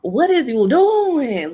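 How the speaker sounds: high, drawn-out disbelief; exasperated scolding. Acted. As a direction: stretch it out slowly, scolding a character on screen. Scolding the character Barry.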